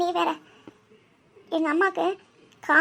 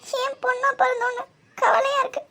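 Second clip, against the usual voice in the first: crying; 7 semitones higher, slower than usual. Acted. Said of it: "Shivani இப்படி அழுதுட்டே இருக்க போற?".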